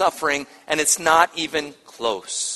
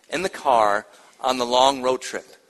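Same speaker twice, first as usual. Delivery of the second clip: ordinary talk, nothing marked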